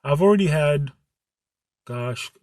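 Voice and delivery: ordinary delivery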